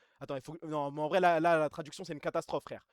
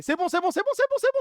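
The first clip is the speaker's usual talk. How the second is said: rising excited; 13 semitones higher, 7 dB louder than usual. Real, not acted. Rassure le chat, montée aiguë.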